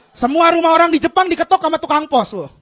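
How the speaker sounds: ordinary talk, nothing marked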